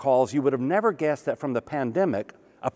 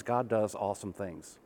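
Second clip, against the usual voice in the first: lower, much softer repeat; soft restatement; 5 semitones lower, 9 dB quieter than usual. Real, not acted. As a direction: let it settle softly. Sermon refrain, quiet repeat.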